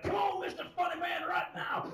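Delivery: high, strained threatening shout; hollered mock challenge; mock tough guy. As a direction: imitate an angry guy calling you out. Imagined angry man after show.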